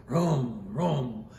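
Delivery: low, drawn-out engine vroom; imitated engine vroom. Survivor imitates the boat's engine heard in the dark, low vroom. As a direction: imitate a diesel engine revving. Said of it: "I heard the engine".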